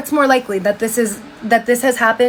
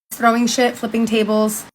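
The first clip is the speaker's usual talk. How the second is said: usual speaking voice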